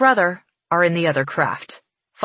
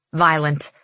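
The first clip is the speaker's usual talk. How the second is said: usual speaking voice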